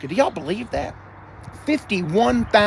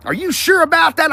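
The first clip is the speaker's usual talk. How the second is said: higher, louder doubtful "Are you sure about that?" with stress on sure; meme impression; 10 semitones higher, 3 dB louder than usual. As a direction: do the skeptical meme guy, leaning on sure. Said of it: "I feel like that guy".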